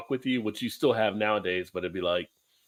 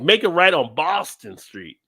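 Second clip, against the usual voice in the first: imitating a Boston GPS voice saying Boston Street; slow mock Boston accent on Boston; a light Bostonian GPS woman; 4 semitones higher, 6 dB louder, slower than usual. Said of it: "she says it as a Bostonian".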